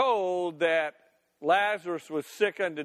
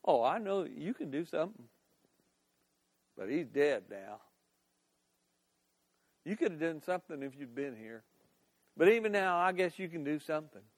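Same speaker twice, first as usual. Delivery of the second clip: low, muted sarcasm with pointed pauses; grudging concession, dragged out between pauses; sarcastic; 3 semitones lower, 5 dB quieter than usual. Acted. Martha, mad at Jesus, answers him sarcastically. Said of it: "she could have said it sarcastically".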